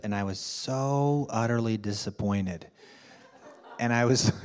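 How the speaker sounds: usual speaking voice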